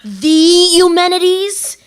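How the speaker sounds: loud, high, long-drawn-out name; awestruck amazement. Acted. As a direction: stretch the name out in astonishment. Awed at the job offer.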